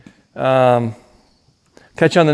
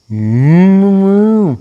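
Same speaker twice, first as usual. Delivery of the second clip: long loud high moo; cow moo; 8 semitones higher, 7 dB louder than usual. Retold or imitated. Imitating cow for dog.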